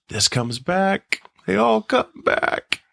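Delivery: mock eerie, rising as it goes; horror-movie voice. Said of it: "It's like a horror movie".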